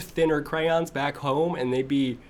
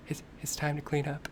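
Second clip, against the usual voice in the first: imitating a whisper; 3 semitones higher, 8 dB quieter than usual. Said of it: "She's, like, whispering".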